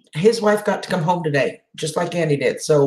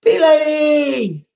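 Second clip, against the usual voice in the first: long, high, drawn-out call; happy shouted hello; calling out a greeting; 11 semitones higher, 4 dB louder, much slower than usual. Real, not acted. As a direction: call her name across the room with delight. Greeting a viewer by nickname.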